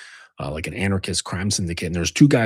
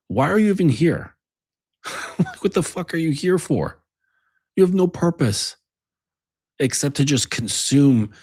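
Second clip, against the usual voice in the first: higher-pitched, incredulous challenge; exasperated berating; 7 semitones higher than usual. Acted. Speaker berates imagined aimless men, exasperated and high. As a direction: demand an answer from someone you find useless.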